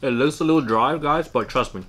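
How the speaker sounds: chewing while talking; talking around a mouthful; mouth full. Real, not acted. Seen 1: Bit duck leg at -3s; speaks with food still in mouth.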